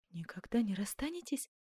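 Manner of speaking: hushed, half-whispered disbelief; amazed question. A narrator's reading. Duchess relieved by Ascanio's promise, hushed amazement. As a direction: ask softly, barely believing it. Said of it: "воскликнула она".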